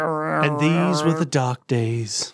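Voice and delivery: slow, low, grave narration; somber documentary narrator. Pretend. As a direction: narrate a bleak old newsreel. Mock documentary about dark days.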